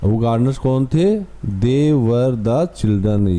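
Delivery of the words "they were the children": very slow, word by word; slow read-aloud. Shown as a demonstration. Teacher reading the sentence to students, very slow. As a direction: read it out slowly for the class. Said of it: "वो बच्चे".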